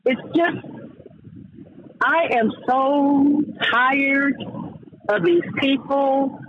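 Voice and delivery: slow, high-pitched exasperation; exasperated, each phrase stretched out; fed up. Real, not acted. Fed-up venting after the lie.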